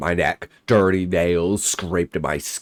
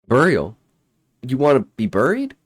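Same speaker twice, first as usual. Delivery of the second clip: surprised; 8 semitones higher, 4 dB louder, faster than usual. Acted. Narrator asks creature, startled.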